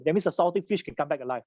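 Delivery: usual speaking voice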